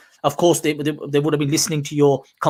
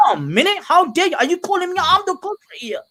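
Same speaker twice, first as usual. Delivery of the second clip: indignant outburst; 12 semitones higher than usual. Pretend. A man grilled by intrusive police snaps back in indignant outrage. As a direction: pitch shooting much higher with mock outrage. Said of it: "I'm gonna react in a way".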